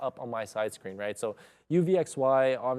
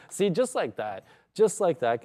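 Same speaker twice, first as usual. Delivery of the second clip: excited; 5 semitones higher, 4 dB louder than usual. Real, not acted. Trade pays off, excited.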